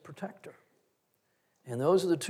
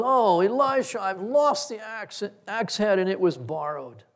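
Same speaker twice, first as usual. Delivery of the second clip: higher, louder mock lament over a lost tool; moaning worker; 5 semitones higher, 5 dB louder than usual. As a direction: whine it like a man who ruined a borrowed axe. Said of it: "he's just moaning over it".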